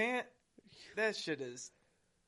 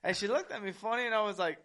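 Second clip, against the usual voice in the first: ordinary delivery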